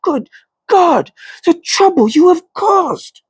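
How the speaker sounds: angry scolding. A narrator's reading. Thomas berates Catherine over the stolen relic, furious. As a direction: berate her for the trouble she caused.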